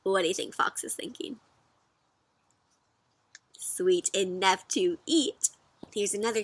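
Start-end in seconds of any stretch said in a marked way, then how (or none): none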